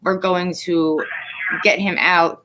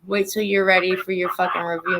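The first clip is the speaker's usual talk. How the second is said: ordinary talk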